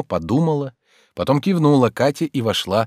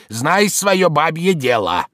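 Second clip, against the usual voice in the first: slow, raised, scornful order; shouted rebuke; 5 semitones higher, slower than usual. A narrator's reading. Servant tells the maid to mind her business, shouting. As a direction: drag out the angry put-down, pitched up. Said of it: "закричал он".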